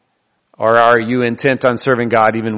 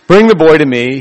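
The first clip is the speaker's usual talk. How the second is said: louder, declaimed Vader quote; Darth Vader line, louder; 4 semitones higher, 7 dB louder than usual. Retold or imitated. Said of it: "tempted to make the Darth Vader sound".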